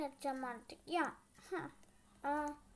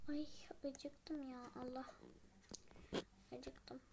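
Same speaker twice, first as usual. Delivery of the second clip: hungry sigh; 3 semitones lower, 10 dB quieter than usual. Acted. Alone at home, whispering she's hungry.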